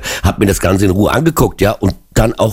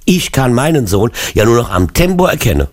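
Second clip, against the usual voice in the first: ordinary talk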